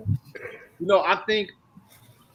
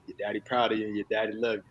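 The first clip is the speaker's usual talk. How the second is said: imitating dad; 6 semitones lower, 8 dB quieter, faster than usual. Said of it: "He always say it, third person".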